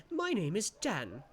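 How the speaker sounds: a proud little sprite; chirpy creature. Acted. Tiny creature introduces itself.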